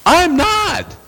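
high, squeaky protest of innocence; indignant denial. Retold or imitated. Pastor protesting to the clerk he's not mad, high indignant squeak.

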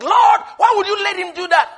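high, loud protest to heaven; complaining prayer. Retold or imitated. Preacher back in prayer questioning the Lord, loud complaint. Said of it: "you get back into prayer".

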